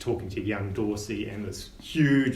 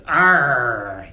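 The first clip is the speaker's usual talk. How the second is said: pirate growl; 10 dB louder than usual. Acted. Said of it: "he goes".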